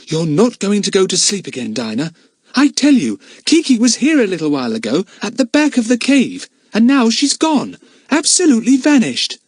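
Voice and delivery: put-on high, urgent little girl voice; insist, worried she's not listening; anxious Lucy Ann. A narrator's reading. Lucy Ann urging sleepy Dinah, high and anxious.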